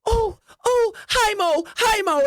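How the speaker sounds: high, bubbly chirp of hello; excited greeting. Acted. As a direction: bounce in, squealing hello. NPC bounces in greeting excitedly.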